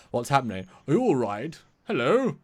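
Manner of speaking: panicked newcomer. Acted. Woman who jumped into the future calls out, alarmed. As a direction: call out anxiously, pitch leaping on each question. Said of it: "she's like".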